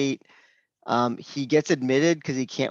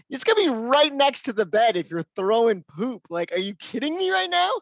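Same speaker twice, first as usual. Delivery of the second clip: much higher, exasperated mock rant; 9 semitones higher than usual. Doctor scolding the patient about throwing poop, high exasperated voice. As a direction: throw up your hands in disbelief.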